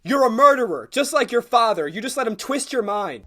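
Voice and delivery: loud, high furious shout; furious shout at the assassin who killed a friend; angry accusation. Acted. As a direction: hurl the accusation at her.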